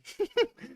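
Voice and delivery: sudden high laugh out loud; a real, high-pitched laugh; burst of laughter. Real, not acted. Player laughs at the Trump bit, real laughter. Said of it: "That made me laugh".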